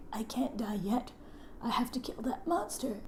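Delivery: faint, very soft rasp of last words; weak dying whisper. Acted. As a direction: whisper it as if barely hanging on to life.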